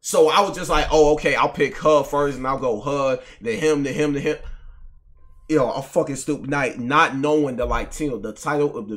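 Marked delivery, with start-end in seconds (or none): none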